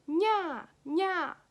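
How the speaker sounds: high, loud playful nya nya; Japanese cat meow. Shown as a demonstration. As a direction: mew like a cute kitten. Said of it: "express cats crying like".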